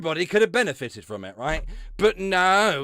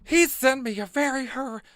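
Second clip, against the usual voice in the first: mocking read; 11 semitones higher, 3 dB louder than usual. Shown as a demonstration. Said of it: "let me read this again".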